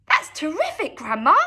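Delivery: put-on boy cries out in delight; excited cry. A narrator's reading. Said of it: "George cried".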